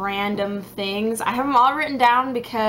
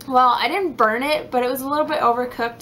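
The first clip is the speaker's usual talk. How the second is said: ordinary talk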